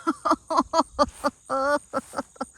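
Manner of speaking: long helpless laugh, high; rueful laugh. Real, not acted. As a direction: laugh helplessly at the rotten pumpkin. Laughing at ruined pumpkin.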